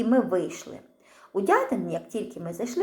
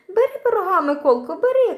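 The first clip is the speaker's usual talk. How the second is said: high, louder coaxing call; aunt urging; 10 semitones higher, 8 dB louder than usual. A narrator's reading. Aunt pushing pie on the boy, high loud coaxing. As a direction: dangle the pie in front of him.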